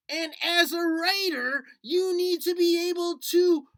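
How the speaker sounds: bright little puppet explaining eagerly; puppet Nutley. Acted. Teacher voices puppet Nutley.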